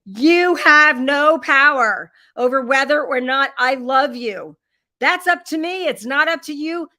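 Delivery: mother yelling at her preteen son that she'll always love him, loud and firm; retold yell. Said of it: "And I yelled at him".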